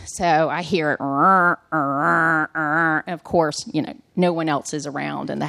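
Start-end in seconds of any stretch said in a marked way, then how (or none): 1.0-3.0 low repeated growling "rrr" of a machine; growled mechanical grinding noise; imitated grinding machine. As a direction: imitate an ice maker grinding away. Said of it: "it starts making this horrible grinding sound".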